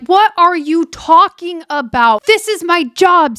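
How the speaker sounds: high, slow exasperated imitation; mock mad. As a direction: act out his indignant disbelief. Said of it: "he's, he's really mad".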